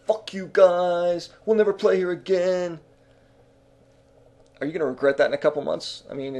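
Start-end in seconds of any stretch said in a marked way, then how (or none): 0.0-2.8 angry kiss-off. Retold or imitated. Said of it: "If you say".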